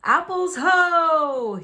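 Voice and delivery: loud, slow, drawn-out call; rallying cry to move out; cried out. A narrator's reading. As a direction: sing it out like a wagon master. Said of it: "he cried".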